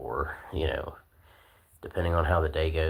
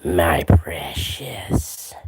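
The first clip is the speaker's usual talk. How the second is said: very slow, loud, harsh whisper; creepy creature whisper; 10 dB louder, much slower than usual. Pretend. Streamer playing a treasure-obsessed creature in a loud, drawn-out whisper. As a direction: rasp it out in a covetous stage whisper.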